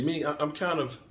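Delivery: usual speaking voice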